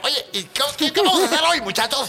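high, eager cartoon-friend voice; the pal who wants to hang out; eager buddy. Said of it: "Es el amigo que quiere dar". (amigo entusiasta con voz aguda)

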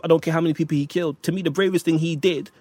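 ordinary talk, nothing marked

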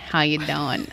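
low-pitched Long Island "how you doin'"; Long Island greeting. Shown as a demonstration. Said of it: "we start every episode with saying".

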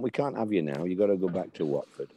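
ordinary talk, nothing marked

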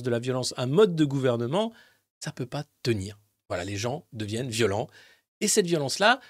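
usual speaking voice

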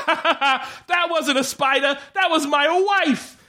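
high, slow, laughing madman; crazy patient. Retold or imitated. Patient in asylum explaining the spider, high, slow, laughing. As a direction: giggle it out like a lunatic.

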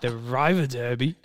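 River Derby in a hammed-up German accent; put-on German accent, playful; mock German. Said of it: "I had to channel my inner German there".

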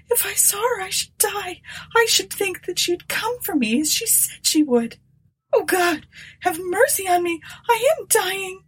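high, trembling whisper breaking into crying; faint with terror, pleading to God; terrified. A narrator's reading.

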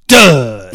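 loud booming sting on dud; dud sound effect. Pretend. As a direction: belt it out like a clang.

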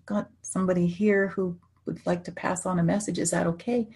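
softly voiced gentle request; gentle ask. Medium gently asking a stranger's permission, softly. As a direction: ease into it, asking kind permission. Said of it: "I would gently ask".

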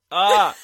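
panicked shriek. Real, not acted. Panicking before the accent.

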